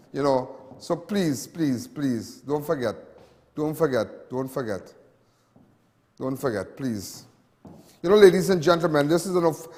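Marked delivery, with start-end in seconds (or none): 0.1-7.2 softer and lower, fading with each please; soft pleading. Real, not acted. Host pleading with viewers to keep praying, soft fading plea. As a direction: beg them gently not to forget.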